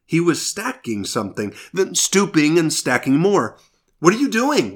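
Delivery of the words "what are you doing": high, anxious question from the dark; a frightened cave dweller calls out nervously; nervous cry. A narrator's reading. Cave dweller nervously calls to the stranger, high nervous cry. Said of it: "one cried nervously".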